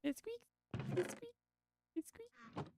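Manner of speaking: very high, soft little squeak; mouse-small squeaky pitch; tiny squeaky voice. Pretend. High squeak on a repeated phrase.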